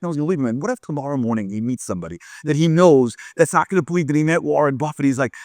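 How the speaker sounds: usual speaking voice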